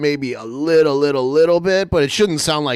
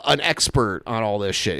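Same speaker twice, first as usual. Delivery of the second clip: normal talking voice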